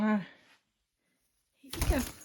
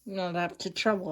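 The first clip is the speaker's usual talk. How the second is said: ordinary delivery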